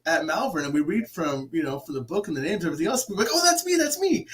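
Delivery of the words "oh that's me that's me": very high, eager squeal of recognition; excited spirit. Acted. Spirits hearing their names read from the book, high excited piping. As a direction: pipe up high like someone hearing their name.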